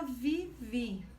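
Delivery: "vivi" in two separate beats, vi, vi; split into syllables. Shown as a demonstration. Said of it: "Vivi tem duas sílabas".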